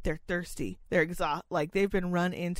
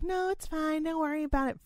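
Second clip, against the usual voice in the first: fake-nice; 8 semitones higher, 4 dB quieter than usual. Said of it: "I was like".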